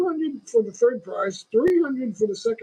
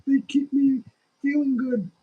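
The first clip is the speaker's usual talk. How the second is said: a hint of an elderly mother, raised and insistent; imitating mother; 5 semitones higher, 3 dB louder than usual. Streamer quoting his mother's refusal to drop pills, higher insistent. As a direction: quote mum's protest a bit higher and louder. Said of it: "and she went".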